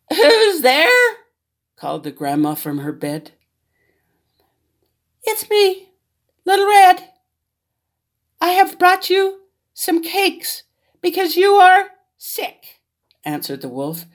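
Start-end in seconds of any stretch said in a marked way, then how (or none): 0.1-1.2 put-on high old grandma calling from bed; a frail granny calls to the door. Grandma in bed calls out to the knock, high. 5.2-12.8 high, loud put-on girl voice from the wolf; the wolf squeaks like Little Red; wolf faking a girl. Wolf fakes Little Red's voice at grandma's door, high and loud. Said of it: "trying to hide his big wolf voice".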